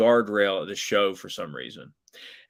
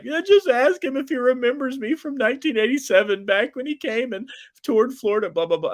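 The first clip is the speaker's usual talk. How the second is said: high, whining falsetto; hammed-up tearful pleading fan; mock crying woman; 5 dB louder than usual. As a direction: imitate a weepy drunk woman, pitched way up. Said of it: "and she's crying".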